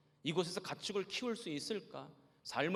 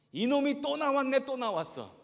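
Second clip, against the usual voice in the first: high loud scolding yell; angry old man yelling; 11 semitones higher, 11 dB louder than usual. Retold or imitated. The old man yelling at the pastor again, high and loud. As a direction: shout it at the young pastor, annoyed. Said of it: "그러면서 막 저를 또 야단을".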